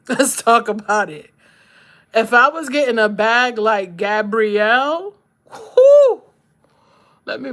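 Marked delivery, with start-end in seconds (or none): 0.1-1.3 loud, laughing let's talk about it. Real, not acted. Laughing repetition. 5.5-6.2 high, loud laughing whoo; a shriek of delight at the thought; excited whoo. Real, not acted. Excited about money.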